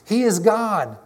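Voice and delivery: preacher voicing a crowd's cry. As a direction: proclaim it like an awestruck crowd. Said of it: "They cried".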